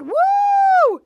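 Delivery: long, very high, loud woo; whooping cheer. Retold or imitated. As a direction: hold a piercing mock whoop. Acted cheer on launch.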